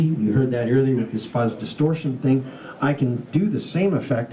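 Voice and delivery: normal talking voice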